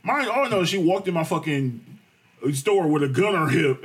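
normal talking voice

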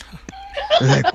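slow, laughing through the words; can't hold back the laugh. Real, not acted. Retelling the crash to friends, laughing.

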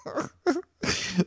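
high little real laugh; chuckle. Real, not acted. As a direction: let a high chuckle slip out. Chuckles before continuing story.

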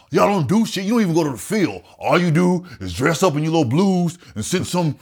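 mock them as an imitated uncle; disdainful uncle. Uncle running down the Air Force to nephew, disdainful.

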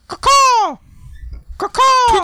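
high shrill caw-caw bird call; crow caws. Pretend. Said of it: "I can do it for you right now".

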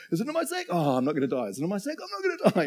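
high-pitched hammed-up panic; mock freaking out. Pastor acting out brothers fearing for their sacks, mock panic. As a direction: play anxious brothers, pitch leaping up. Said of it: "everyone's freaking out".

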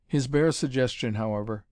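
ordinary delivery, nothing marked

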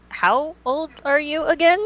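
usual speaking voice

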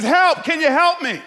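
loud, high acted plea; calling for help. Man hanging off a cliff begs the voice above, calling out. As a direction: shout desperately up to whoever is there.

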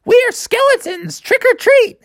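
put-on high-pitched chorus of children; kids trick-or-treating. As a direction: announce yourselves in high kid voices. Kids announce their costumes.

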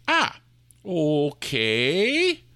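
ordinary talk

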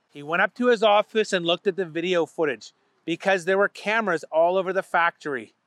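usual speaking voice

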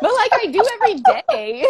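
laughing through the words, high and loud; real laugh spilling over her words to the host. Real, not acted. Laughing about driving the truck.

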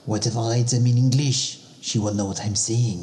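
a Frenchman plotting mischief; comic French accent. Acted. Same French-accented character.

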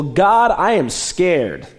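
slow, high, pleading cry to God; scared prayer. Acted. Preacher voicing a frightened prayer, slow and pleading. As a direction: stretch each word out like a plea.

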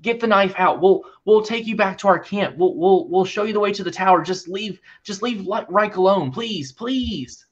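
high, frantic pleading, fast in spots; desperate begging. Acted. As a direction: beg for your friend's life. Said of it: "he's like".

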